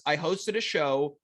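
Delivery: ordinary delivery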